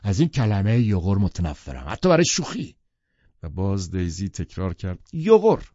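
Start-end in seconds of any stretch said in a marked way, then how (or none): 0.0-2.8 Tom, irritated, rising as he goes; annoyed. A narrator's reading. Tom objecting to a word Daisy used, annoyed. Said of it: "تام با دلخوری گفت". 5.1-5.7 playful, high, loud jab of a word; teasing repeat. A narrator's reading. Daisy teasing Tom by repeating the word, playful.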